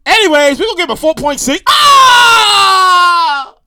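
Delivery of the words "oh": loud, high, long-drawn wails; outrage. Retold or imitated. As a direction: howl in hammed-up protest at the score. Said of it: "It was such outrage".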